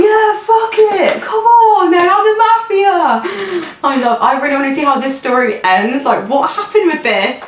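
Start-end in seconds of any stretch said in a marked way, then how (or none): 0.0-3.2 cocky mafia bravado. Retold or imitated. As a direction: imitate a man embracing mafia life, high. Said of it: "took it in his stride".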